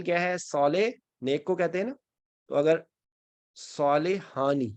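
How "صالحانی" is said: صالحانی stretched very slowly, saa-li-haa-ni; slow teaching example of صالحانی; drawn-out example. Shown as a demonstration. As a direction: draw out صالحانی to show the aani ending. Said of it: "اس کا مطلب ہے دو صالح".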